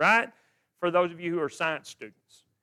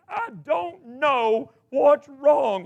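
slow, higher and louder, imitating a sobbing teen; retold bawling; 6 semitones higher, 5 dB louder, much slower than usual. Pastor imitating his bawling daughter, slow and tearful. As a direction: drag it out like a crying daughter who can't explain. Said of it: "And she's just bawling".

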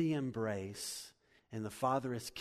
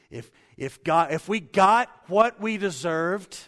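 loud, higher preaching burst; preaching emphasis; 7 semitones higher, 15 dB louder than usual. Real, not acted. Preacher pressing his congregation about deserved judgement, loud and emphatic. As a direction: drive the point home at full force.

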